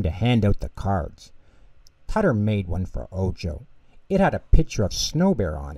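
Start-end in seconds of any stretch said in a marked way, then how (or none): none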